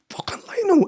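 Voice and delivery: high, loud exasperated yell; losing it. Retold or imitated. Coach at linesman over missed foul, high angry yell. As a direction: shoot the voice up in outrage. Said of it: "I lose my shit".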